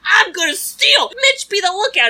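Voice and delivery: hammed-up delinquent pal; bossy friend. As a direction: order the lookout like a thrilled troublemaker. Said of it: "Don's like".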